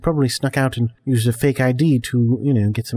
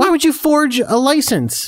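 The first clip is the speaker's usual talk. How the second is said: stern scolding; 12 semitones higher, slower than usual. Acted. Dad scolding son.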